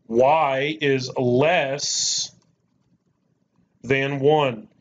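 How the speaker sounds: very slow, pausing between words; teacher reading the symbol out slowly for the class; slow emphatic read. Shown as a demonstration. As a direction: drag it out so each word lands.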